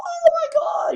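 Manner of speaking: mock wail. Dying people in cartoon.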